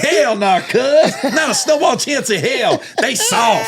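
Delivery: laughing, animated trash-talk imitation; retold trash talk. Imitates Marshawn trashing his team.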